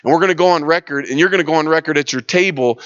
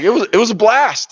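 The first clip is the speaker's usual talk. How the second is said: higher-pitched, upbeat quote; delighted retelling; 8 semitones higher than usual. Retold or imitated. Tailor telling of his jail time, mock delight. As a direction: relive it with mild delight.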